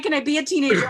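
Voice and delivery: high, loud re-enacted exasperation; exasperated teen protest. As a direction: plead loudly as an overburdened teenager. Exasperated protest of her teenage self.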